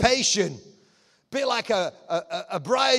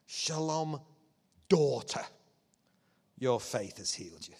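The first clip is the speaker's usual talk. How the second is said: gentle blessing; 6 semitones lower, 5 dB quieter than usual. Retold or imitated. Jesus blesses healed woman.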